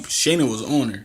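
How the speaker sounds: normal talking voice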